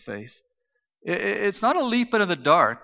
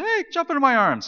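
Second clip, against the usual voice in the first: put-on calling to kids; 10 semitones higher, 4 dB louder than usual. Dad to kids on the stage, eager high call. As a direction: invite them eagerly, pitched up high.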